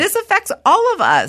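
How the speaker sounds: high-pitched indignant protest; worked-up retold complaint; upset retort. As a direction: insist they share the upset, pitched high. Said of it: "I'm fucking upset".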